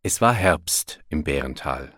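ordinary delivery

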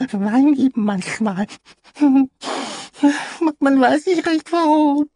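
put-on very high, loud crying child voice; tearful child. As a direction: weep through it as a tiny boy. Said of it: "fing Ping-Pong an zu weinen".

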